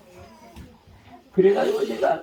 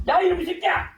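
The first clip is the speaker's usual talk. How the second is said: higher, louder angry scolding; angry yell; 13 semitones higher, 3 dB louder than usual. Retold or imitated. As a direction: tear into him for keeping silent. Brother scolding silent brother. (말 안 하는 동생을 꾸짖는 형의 화난 고함)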